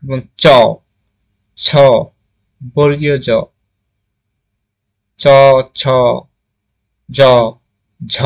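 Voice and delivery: চ, ছ, জ, ঝ spaced out, each said against the front palate; palatal sounds modelled. Shown as a demonstration. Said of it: "একবার উচ্চারণ করে দেখো".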